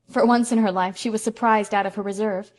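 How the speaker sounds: ordinary delivery, nothing marked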